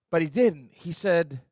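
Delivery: ordinary delivery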